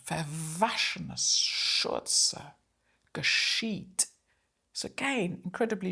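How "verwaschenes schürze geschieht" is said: "verwaschenes", "Schürze", "geschieht" spoken slowly, each "sch" hushed and drawn out; whisper-soft sh in each word; soft sh sounds. Shown as a demonstration. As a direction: hush every sh to show the softness. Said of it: "with the sh sound".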